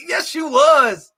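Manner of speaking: high, slow mock shout; mock insistent shout. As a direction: shout it back like a playful argument. Mock quarrel with a viewer.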